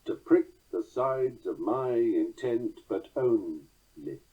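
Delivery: slow, every second syllable stressed in "To prick the sides of my intent"; scanned iambic reading. Shown as a demonstration. Lecturer scanning the line, slow stress on each second syllable, "only" left falling. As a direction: beat out PRICK, SIDES, MY, TENT, ON, dropping on the final "ly" of "only". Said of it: "The line scans like this".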